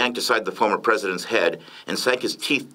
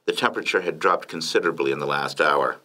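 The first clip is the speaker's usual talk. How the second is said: ordinary delivery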